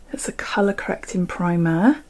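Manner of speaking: soft unvoiced aside about hand creams; breathy confiding whisper. Real, not acted. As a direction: whisper it like a secret to the viewer. Aside while showing primer.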